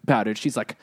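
normal talking voice

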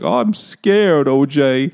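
high, drawn-out mock fear; dopey scared guy. Charlie frightened of O J, dopey and hammed-up. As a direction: whine it like a scared dope. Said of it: "dopey guy who's like".

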